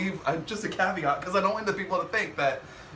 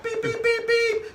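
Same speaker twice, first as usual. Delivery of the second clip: high-pitched mimicked beeps; beeping noise; 4 dB louder, slower than usual. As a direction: squeak out beep beep. Imitating insulin device beeps.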